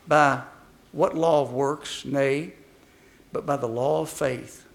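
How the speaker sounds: usual speaking voice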